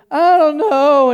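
loud, drawn-out nervous protest; mock panic. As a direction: imitate a kid hesitating up high. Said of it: "he's, like, panicking".

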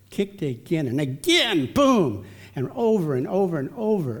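animated kick reenactment. Retold or imitated. Storyteller relives mother giraffe kicking baby, animated reenactment. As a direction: swing up into the blow, then roll along. Acts out repeated kicks and tumbling.

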